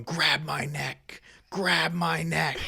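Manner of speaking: slow, otherworldly demon voice; demonic voice. Retold or imitated. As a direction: drag each word like a demon. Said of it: "in this, like, demonic voice".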